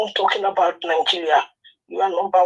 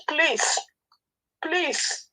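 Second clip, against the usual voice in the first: pleading; 7 semitones higher, 5 dB quieter than usual. Real, not acted. Caller pleading while crying.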